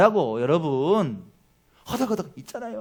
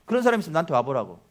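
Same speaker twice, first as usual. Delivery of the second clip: ordinary talk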